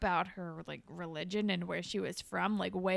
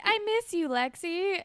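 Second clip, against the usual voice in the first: high, louder imitation of a lovelorn plea; mock pleading; 9 semitones higher, 4 dB louder than usual. Boy pleading with the girl who turned him down, mock wistful.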